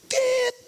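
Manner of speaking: high-pitched imitated bleep; censor beep. Preacher bleeping out a worldly person's swearing, high censor beep. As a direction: squeak out a sustained censor beep. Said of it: "Terus ngomongnya jorok".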